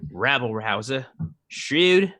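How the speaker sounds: mostly low Australian character voice; Australian accent. Acted. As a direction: list it off in a Cockney-tinged Australian accent. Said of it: "Just go Australian".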